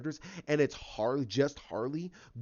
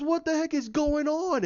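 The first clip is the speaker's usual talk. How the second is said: Penguin baffled; 11 semitones higher, 5 dB louder than usual. Retold or imitated. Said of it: "he goes".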